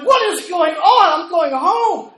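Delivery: very loud, high comic outburst; hammed-up bewildered yell; acted exasperated shout. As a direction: holler as if fed up with it all. Referee's exasperated inner outburst.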